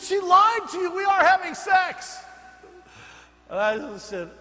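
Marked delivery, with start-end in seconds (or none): 0.0-2.2 loud, high, crying-out confession; anguished shout. Retold or imitated. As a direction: blurt the truth out at full volume. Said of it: "He said".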